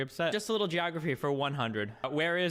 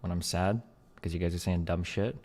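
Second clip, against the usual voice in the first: much lower, chilled-out character voice; deep Evans voice; 8 semitones lower than usual. Acted. Showing friends the Evans voice, deep and chilling. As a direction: drop it deep and laid-back. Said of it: "Evans's voice got deeper after he died".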